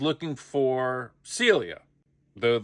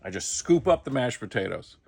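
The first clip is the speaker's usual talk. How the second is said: ordinary talk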